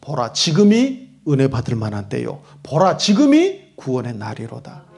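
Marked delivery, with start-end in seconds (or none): none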